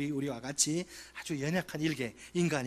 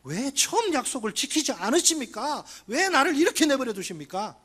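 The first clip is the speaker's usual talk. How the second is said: higher, louder indignant pleading; protesting demand; 8 semitones higher, 4 dB louder than usual. Retold or imitated. Jeremiah confronting God, higher, louder indignant protest. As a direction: press God hard for answers. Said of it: "이렇게 마구 따지는 거예요".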